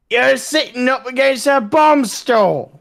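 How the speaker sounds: slow, high Cockney yell; Cockney shout. A narrator's reading. Cockney soldier warning men by a bomb store, high drawn-out shout. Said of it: "we heard a Cockney voice shouting".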